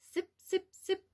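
playful high sipping; tiny sips. Acted. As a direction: make little dainty sips. Butterfly sipping nectar.

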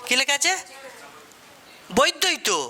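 ordinary talk, nothing marked

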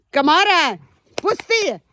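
high, loud command to the dog; sharp shouted order, pitch up; calling out. Real, not acted. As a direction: yell at the dog to let go.

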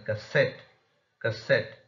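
light first syllable, strong ending in "cassette"; stressed suffix. Shown as a demonstration. Teacher shows suffix rule, cassette stressed on last syllable. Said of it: "suffixes will only receive the stress".

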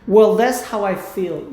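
slow, drawn-out line; slow drill. Shown as a demonstration. Teacher drills the reply slowly. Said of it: "slow repetition drill".

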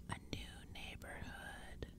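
slow, very soft creature croak; croaking whisper. A narrator's reading. Pumpkinhead ignoring demands to stop, croaking in a whisper. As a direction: whisper it hoarse and drawn out. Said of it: "one of them croaked".

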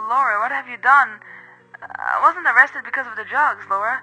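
put-on a frantic mother realising her child is gone, voice strained and high; anguished, high cries of a mother. A narrator's reading. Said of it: "my mother's anguished cries".

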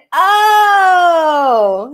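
long, loud, high "oh"; relieved group "oh". A narrator's reading. Everyone realizing the ghost is a sloth, drawn-out "oh". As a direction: draw out a big swooping "oh".

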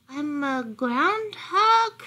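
slow, high, hesitant answer; little dog voice. A narrator's reading. Lost dog introducing himself to fox, high-voiced. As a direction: answer high and drawn out, unsure of it.

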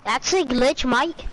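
casual put-on British voice; British accent. Explaining a glitch to the teammate, British accent. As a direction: drop in a British-accented remark. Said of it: "how do you speak like that?".

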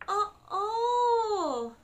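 high, drawn-out uh-oh; mock dread. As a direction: play up the dread of what comes next. Reader dreads the coming words.

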